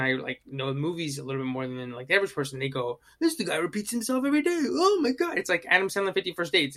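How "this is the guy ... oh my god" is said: high, quick mock movie-watcher voice; hammed-up imitation of someone gushing over a silly movie; wacky, goofy. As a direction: put on a goofy, high-pitched voice. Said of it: "it's wacky, goofy".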